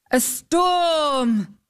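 high, drawn-out exclamation; exclaimed. A narrator's reading. Knight alarmed by the storm, exclaiming. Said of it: "exclaimed the knight".

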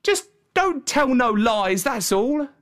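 high, protesting country lad; lad's voice, pitched well up; shepherd boy. A narrator's reading. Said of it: "said the boy".

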